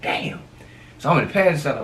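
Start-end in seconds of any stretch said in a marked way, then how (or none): 0.0-0.4 soft unvoiced damn; whispered damn. Whispering in the car as cops stop them. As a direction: breathe it under your breath.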